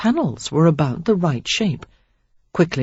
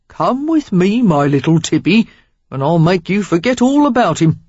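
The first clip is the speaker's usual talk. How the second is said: louder, coaxing Cockney invitation; inviting Cockney; 3 semitones higher, 5 dB louder than usual. A narrator's reading. Stranger inviting Sarah to leave, coaxing Cockney. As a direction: lure her away like a London rogue. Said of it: "a healthy dose of Cockney in his accent".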